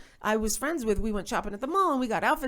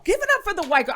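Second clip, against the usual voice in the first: higher, louder hype call; bigger emcee shout-out; 10 semitones higher, 6 dB louder, much faster than usual. Retold or imitated. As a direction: push the crowd even harder. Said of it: "he goes".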